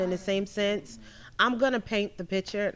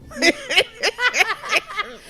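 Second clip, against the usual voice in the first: high burst of laughter; loud laugh; 14 semitones higher, 7 dB louder than usual. Real, not acted. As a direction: laugh it off loudly. Laughing at accusation.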